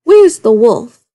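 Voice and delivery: loud, slow urgent call; cried out. A narrator's reading. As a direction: shout it to the boy. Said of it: "a villager cried out".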